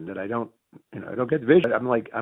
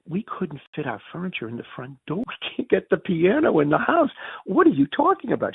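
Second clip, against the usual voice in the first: rising disbelief; 6 semitones higher than usual. Retold or imitated. Retold protest to wife.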